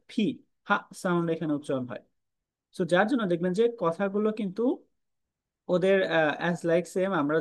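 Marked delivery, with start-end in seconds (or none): none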